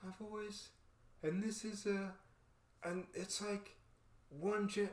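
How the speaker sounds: soft, halting awe; quiet overwhelmed stammer; choked up. Retold or imitated. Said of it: "I'm like".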